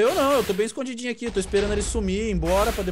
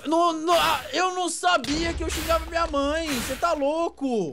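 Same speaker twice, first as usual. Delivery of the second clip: drawn-out, high wail of denial; high-pitched protest; 8 semitones higher, slower than usual. Real, not acted. Accused friend denying he cursed his mother, high protesting wail. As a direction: plead innocence high and slow.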